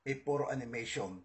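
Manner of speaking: usual speaking voice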